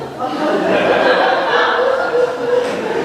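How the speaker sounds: unvoiced giggle burst; high laugh. Real, not acted. Teacher laughing while telling of the pastor in robes, giggling. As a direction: laugh at the memory.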